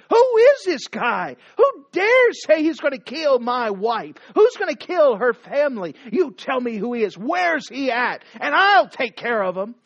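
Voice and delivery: mad king. Acted. King furiously demanding who threatens his wife, loud. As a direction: demand the culprit's name in fury. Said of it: "He's mad".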